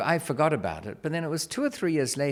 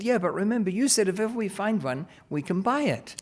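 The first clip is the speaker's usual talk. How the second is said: higher-pitched imitation of an insistent kid; insistent child; 7 semitones higher than usual. Daughter reminding dad of his promise, insistent. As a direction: press the point like a child.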